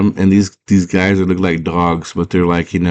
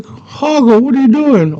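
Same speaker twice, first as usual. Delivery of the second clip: put-on high, slightly louder girl voice; squeaky Sarah; 3 dB louder than usual. Sarah surprised to find Hoggle in the dark, high squeaky voice.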